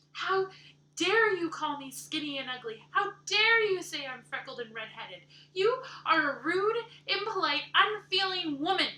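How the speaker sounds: loud, high, indignant shouting; furious outburst. Acted. Anne raging at Missus Rachel for insulting her looks. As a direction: hurl the insults back at her.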